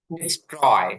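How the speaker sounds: "ডিস্ট্রয়" with a plain t after s, no puff; unaspirated T. Shown as a demonstration. Teacher explains t after s, "ডিস্ট্রয়" with unpuffed t. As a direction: say "ডিস্ট্রয়" with t, never th. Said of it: "ঠয়ের মতন উচ্চারণ হবে না".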